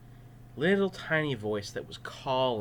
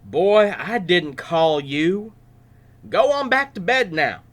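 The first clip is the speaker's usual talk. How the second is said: put-on grumpy old priest sending boy away; old-timey grumpy old man; 6 semitones higher, 7 dB louder than usual. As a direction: shoo the boy back to bed. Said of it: "That's how they sounded back then".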